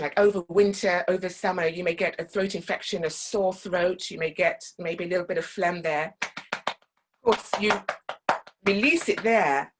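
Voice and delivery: ordinary talk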